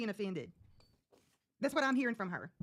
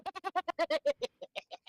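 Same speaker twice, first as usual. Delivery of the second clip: high laugh; 9 semitones higher than usual. Real, not acted. Laughing at the breathless friend.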